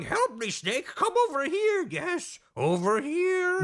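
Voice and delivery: high, squeaky-pitched luring plea; hammed-up high-pitched scientist; scientist calling for help. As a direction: coax someone over in a high silly voice. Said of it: "there's a scientist going".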